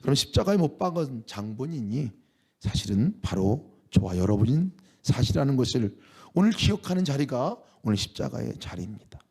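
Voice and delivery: ordinary delivery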